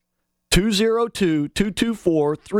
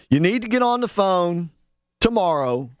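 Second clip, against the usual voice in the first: ordinary delivery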